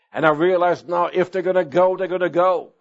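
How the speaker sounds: ordinary talk, nothing marked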